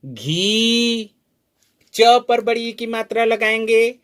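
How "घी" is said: घी with a long held ई; modelling a long घी. Shown as a demonstration. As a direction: stretch घी. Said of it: "क्या हो जाएगा?".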